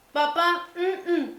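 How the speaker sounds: high, loud child imitation with grunts; niño que no habla. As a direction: call Dad, then grunt your demand. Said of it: "el niño dice". (imitando a un niño que llama y pide con quejidos)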